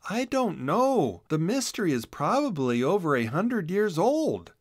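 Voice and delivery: a hint of a doubtful rabbit girl; Boodle voice. A narrator's reading.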